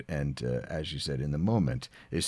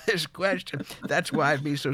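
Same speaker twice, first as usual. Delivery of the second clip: high, laughing through the words; 7 semitones higher than usual. Real, not acted. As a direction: break into a laugh. Retelling being challenged, laughs.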